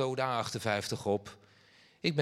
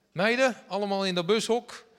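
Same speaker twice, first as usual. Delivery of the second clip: put-on loud, high call to the kids; calling out to the girls; 7 semitones higher, 6 dB louder than usual. Father loudly calls his daughters into the bus shelter. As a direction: shout them over into the bus shelter.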